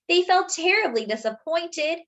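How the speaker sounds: ordinary delivery, nothing marked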